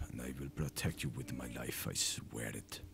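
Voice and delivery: lightly hammed-up fake Russian accent; a mock-solemn vow in a bad Russian accent. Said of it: "I just like speaking in a Russian accent".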